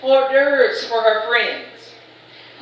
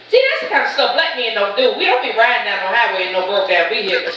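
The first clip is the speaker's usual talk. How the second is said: ordinary delivery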